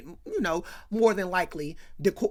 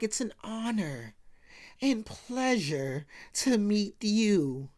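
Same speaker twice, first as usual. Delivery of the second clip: very slow, drawn-out reverent gushing; Jimmy, choked up; much slower than usual. Acted. Jimmy meets Harriet at last, hammed-up choked up. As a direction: overact being overcome, stretching each word. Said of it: "Jimmy's all choked up".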